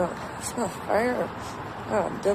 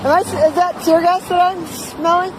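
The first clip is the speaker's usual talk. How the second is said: high, loud startled question; alarmed surprise; 7 semitones higher, 8 dB louder than usual. Real, not acted. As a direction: realize it's tear gas, alarmed. Smelling the tear gas suddenly.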